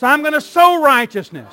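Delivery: loud, rallying call to the congregation; fervent church shout; preacher's declaration. Real, not acted. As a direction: call it out for the crowd to repeat.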